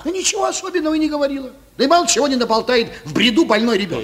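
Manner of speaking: high-pitched, rushed brushing-off; hasty dismissal. Acted. Doctor covers for the sick girl, hastily dismissive. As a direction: wave it away too eagerly.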